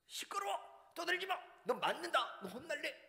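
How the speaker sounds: high, loud shouting at noisy pupils; scolding teacher yell. Retold or imitated. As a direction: bark it like an angry teacher. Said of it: "그런데 이상한 건 뭐냐면".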